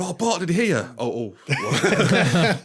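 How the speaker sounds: high, loud frantic Homer; panicked Homer. Retold or imitated. Podcaster quoting Homer running in about the megaphone, frantic. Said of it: "And Homer runs in going".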